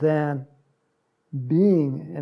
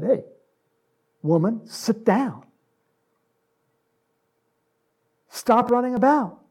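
rising, louder hammed-up scolding; mock rebuke; 7 semitones higher, 6 dB louder than usual. Jesus rebuking Martha to sit down, building mock scold. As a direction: play a stern master telling her off. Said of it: "just said".

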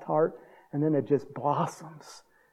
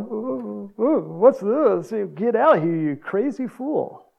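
high, drawn-out spooked dismissal; hammed-up alarm, loud and high; mock alarmed townspeople; 6 semitones higher, 4 dB louder, slower than usual. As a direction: imitate wary locals shooing a madman away. Townspeople reacting to the former madman.

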